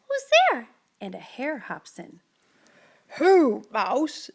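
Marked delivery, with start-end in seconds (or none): 0.1-0.7 high, loud mouse squeak; a tiny startled mouse calling out; squeaky mouse cry. Acted. Mouse calls out into the dark den. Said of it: "Mouse cries". 3.2-4.3 louder, lightly voiced hare; a friendly hare recognising Mouse. Hare greets mouse in the den. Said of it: "says Hare".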